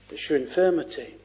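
"It's your infirmity" dropped low, soft and fast; quiet, quick aside. Shown as a demonstration. Preacher repeating the rebuke to himself, softly. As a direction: murmur it back to yourself.

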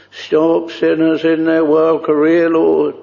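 ordinary talk, nothing marked

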